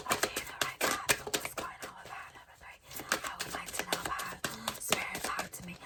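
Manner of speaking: hushed whisper, quick. Real, not acted. Reader whispering questions to spirit about the pile, whisper.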